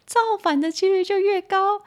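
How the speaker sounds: 猛然拔高音调强调 "造反"; 激动地抬高声音; emphatic rise. Real, not acted. 强调革命后果. (情绪激动地强调后果)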